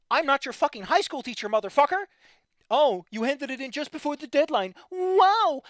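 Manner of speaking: high, loud indignant outburst; angry yelling. Real, not acted. Streamer scolding a friend over late answers, angry yelling. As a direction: tell him off, loud and scornful. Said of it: "I get to yell".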